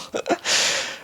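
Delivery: embarrassed little laugh; a soft teary laugh; soft breathy laugh. Real, not acted. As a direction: laugh it off quietly. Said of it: "I get emotional".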